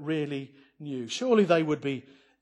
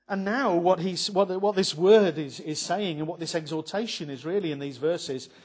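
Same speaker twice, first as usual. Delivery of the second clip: usual speaking voice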